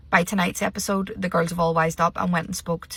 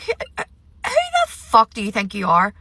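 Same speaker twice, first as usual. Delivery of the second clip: louder indignant retort in a put-on voice; mock outrage; 3 semitones higher, 5 dB louder than usual. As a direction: snap back at him as if face to face. Imagined retort to Simba.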